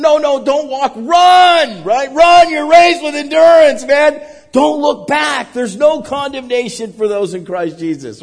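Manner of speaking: high, loud rallying cry to the congregation; preaching shout. Acted. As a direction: shout it like a revival preacher urging them to run. Preaching at full force.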